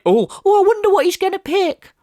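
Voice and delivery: high-pitched sarcastic wondering; mock suspense. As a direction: feign breathless curiosity about an obvious answer. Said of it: "we're supposed to be in suspense".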